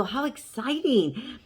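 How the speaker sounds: impressed and encouraging, re-enacted; excited reply. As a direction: gush it slowly, swooping through the words. Retelling her own excited reply.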